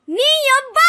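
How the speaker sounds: high, sustained yell; yelled curse. Acted. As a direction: cry out the swearword at a high pitch. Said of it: "ఇప్పుడు ఇంత అరవలేదు తెలుసా".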